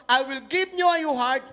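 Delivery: solemn scripture proclamation; scripture reading. Acted. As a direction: proclaim the verse slowly. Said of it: "Old Testament to".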